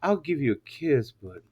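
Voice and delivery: low, soft mock sweet talk; deep, hushed retold bedroom voice. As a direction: imitate him letting her down gently in a deep voice.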